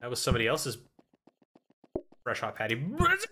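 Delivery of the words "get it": excited. Real, not acted. Frantic kitchen rush, high cry.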